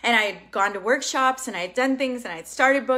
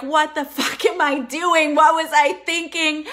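panicked self-doubt; 6 semitones higher, 6 dB louder than usual. Retold or imitated. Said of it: "as like".

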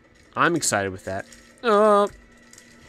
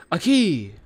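1 excited cry; 6 semitones higher, 5 dB louder than usual. Real, not acted. Finds key.

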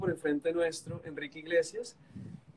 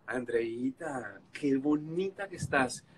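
sweet flattery; 4 semitones lower, much slower than usual. Retold or imitated. Second flattering attempt.

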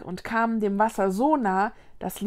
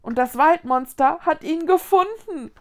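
higher, rising alarm; dismay building to a raised, horrified exclamation; horrified cry; 5 semitones higher, 5 dB louder than usual. A narrator's reading. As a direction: call it out aghast. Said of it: "rief Mila entsetzt".

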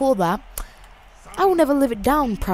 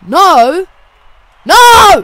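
escalating high shouts of refusal; loud gamer cry of dismay; shouted no; 9 semitones higher, 16 dB louder than usual. Real, not acted. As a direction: yell it, then yell it higher and louder. Losing in the game.

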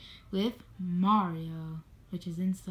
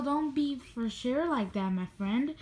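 usual speaking voice

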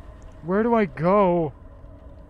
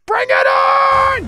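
high, slow, loud dare; battle cry; 10 dB louder, much slower than usual. Acted. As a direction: scream it out, drawn out.

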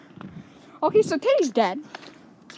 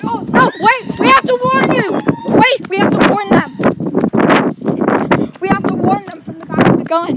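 loud, raised urgent call; urgent warning; 5 semitones higher, 8 dB louder than usual. Real, not acted. Player warning people about the gunman, loud urgent call. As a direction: yell the warning out.

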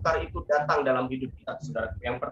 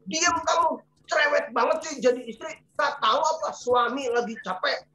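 angry husband snapping; 8 semitones higher than usual. Retold or imitated. Pastor voicing a husband scolding his wife, high angry snap. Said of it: "suami Ibu marah-marah".

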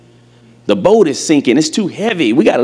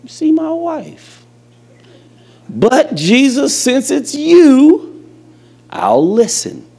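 high, weary fisherman's voice that slows to a drag; tired Peter; 12 semitones higher than usual. Acted. Peter complaining to Jesus after a long night, high and weary. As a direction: sigh it out like a worn-out fisherman who wants to go home.

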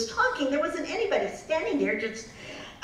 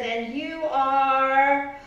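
slow skeptical question; 3 dB louder, much slower than usual. Acted. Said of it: "Cinderella said".

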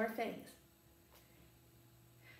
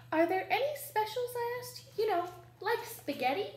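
put-on eager child; 10 semitones higher, 6 dB louder than usual. A narrator's reading. Child asking lion hopefully.

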